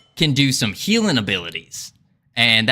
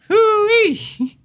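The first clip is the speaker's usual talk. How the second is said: very high cowboy whoop; hammy southern yelp of joy; southern woo-wee; 4 dB louder than usual. Pretend. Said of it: "Can I put this southern accent off yet".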